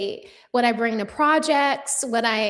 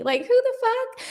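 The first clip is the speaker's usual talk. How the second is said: sassy; 12 semitones higher than usual. Real, not acted. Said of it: "You do sound sassy".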